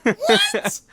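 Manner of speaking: very loud falsetto squeak; mock shocked squeal. Repeats retold shock at sign.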